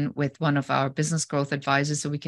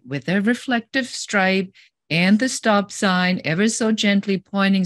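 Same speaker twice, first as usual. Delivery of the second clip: normal talking voice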